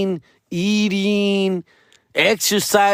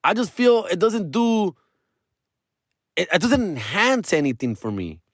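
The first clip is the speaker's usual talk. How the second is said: ordinary talk, nothing marked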